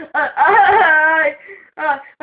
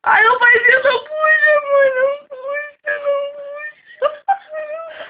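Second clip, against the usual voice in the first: crying and pleading; 7 semitones higher than usual. Real, not acted. Crying as the mother pulls.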